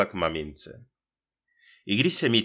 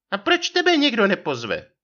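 high, louder challenging wife; vyčítavá manželka; 6 dB louder than usual. A narrator's reading. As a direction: needle him in a put-on high voice. Wife retorts in quarrel. (útočná otázka vysokým hlasem manželky)

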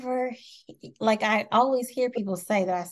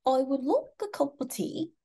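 higher-pitched put-on English accent; imitated English accent; 6 semitones higher than usual. Shown as a demonstration. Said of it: "see in my English voice".

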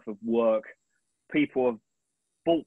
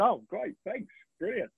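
delighted; 9 semitones higher than usual. Retold or imitated. Retold reply to praise.

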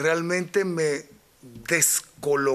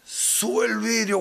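mugger's voice; 5 semitones higher, much slower than usual. Retold or imitated. Robber warns him.

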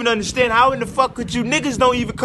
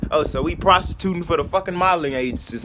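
ordinary delivery

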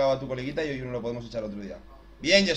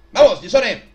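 shouted, rising cheer; loud cheer; 5 semitones higher, 12 dB louder, slower than usual. Real, not acted. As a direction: shout encouragement. Cheering during match.

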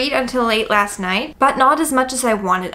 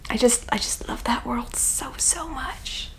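whispering; 9 dB quieter than usual. Real, not acted. Whispered love for the world.

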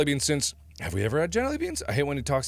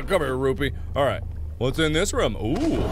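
ordinary delivery

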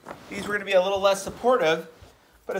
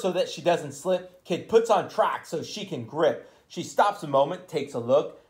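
usual speaking voice

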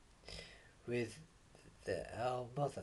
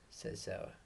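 usual speaking voice

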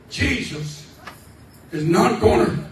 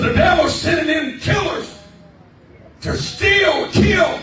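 shouted, much higher and louder; preaching at full force; 8 semitones higher, 7 dB louder than usual. Acted. Preacher warning the church about the devil, fiery shout. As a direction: hurl the warning at the room.

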